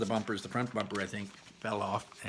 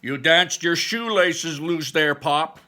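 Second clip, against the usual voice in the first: amused teasing; 6 semitones higher, 10 dB louder, slower than usual. A narrator's reading. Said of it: "laughs said Barley".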